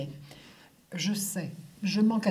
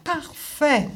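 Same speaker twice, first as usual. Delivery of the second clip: higher-pitched, ringing exclamation; delighted exclamation; 5 semitones higher, 4 dB louder than usual. A narrator's reading. Milliner applauding the young seamstress's answer, exclaimed. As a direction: exclaim it with sudden approval. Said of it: "S'exclama madame Joséphine".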